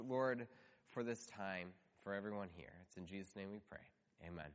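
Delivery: soft, hushed pastoral prayer. Acted. Pastor praying over the congregation, soft and earnest. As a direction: pray quietly and earnestly to God.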